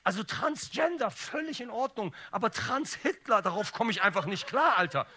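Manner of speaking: loud, high-pitched comic rant; exasperated outburst. Acted. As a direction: blurt it out, loud and worked up. Acted exasperated outburst in stand-up routine.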